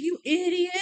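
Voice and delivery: high-pitched exasperated jab; incredulous, high teasing insult; mock scolding. Mocking the laughing crowd.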